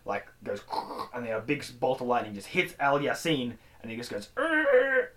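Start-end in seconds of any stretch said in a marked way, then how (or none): 4.4-5.1 high, juddering mock groan; electrocuted groan. Villain hit by lightning, mock electrocuted groan. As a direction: imitate being zapped.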